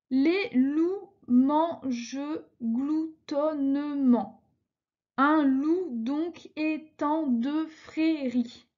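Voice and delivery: slow, syllable by syllable verse reading; scanning syllables slowly. Shown as a demonstration. Teacher scanning a verse for her class, slow and measured. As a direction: count out each syllable of the line as you read. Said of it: "je vous ai fait le découpage".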